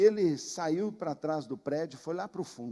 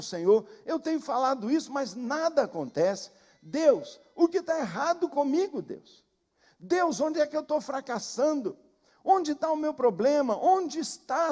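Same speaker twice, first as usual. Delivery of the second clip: higher and louder, crying out to God; anguished pleading prayer; 6 semitones higher, 4 dB louder than usual. Retold or imitated. A pastor under a bush begging God for answers, pleading loudly. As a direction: pour out a desperate plea, pressing harder and harder. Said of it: "dizendo assim".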